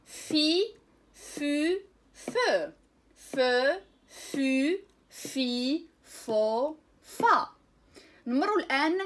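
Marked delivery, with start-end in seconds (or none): 0.1-7.5 loud teacher call for the class to echo; teacher leads students in repeating syllables; repeat-after-me drill. Shown as a demonstration. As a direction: say each syllable so they can repeat it. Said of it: "عاودوا معايا".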